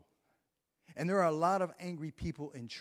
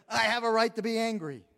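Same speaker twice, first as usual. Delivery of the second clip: loud, higher defiant outburst; angry Jonah; 6 semitones higher, 10 dB louder than usual. Acted. Jonah defending his anger to God, loud and indignant. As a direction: bark it back at God in indignation.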